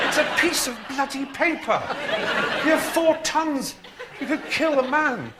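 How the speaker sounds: high, comic rant at a silly horse; mock exasperation. Exasperated rider scolds nervy horse.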